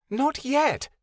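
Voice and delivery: high, small fairy voice speaking of herself; a tiny high fairy, hissing; fairy Vixen voice. Acted.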